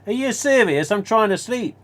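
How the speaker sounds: high, loud, fed-up complaint; exasperated outburst. Retold or imitated. Woken by a fan's question at night, high exasperated shout. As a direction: yelp it like you've been woken up.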